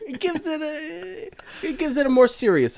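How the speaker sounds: high, laughing through the words. Real, not acted. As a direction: giggle while saying it. Laughing at nude photo joke.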